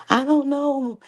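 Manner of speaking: high mock helpless voice; Tim clueless. Tim answering his mom, high mock clueless voice. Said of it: "he was just like".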